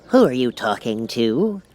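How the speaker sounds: slow, otherworldly king; a curious old king. Acted. The King asks Alice, curious king voice. King's voice.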